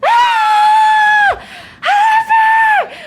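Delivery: very high, loud, drawn-out scream; excited yell. Acted. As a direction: shriek it out at the top of your range. Game master voices Monty crying out.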